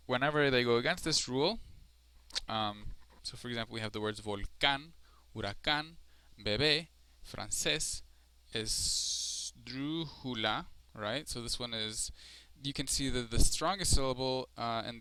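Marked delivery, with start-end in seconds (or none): 4.2-8.0 volcán, huracán, bebé, francés with strong endings; stress on last syllable. Shown as a demonstration. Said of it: "is the last syllable". 8.5-10.7 esdrújula very slowly, with a held DRÚ; stress on third-to-last. Shown as a demonstration. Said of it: "it's the third to last syllable".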